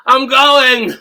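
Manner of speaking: high, loud moaning; hammed-up climax moan; fake orgasm. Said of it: "Did D B just have a fake orgasm".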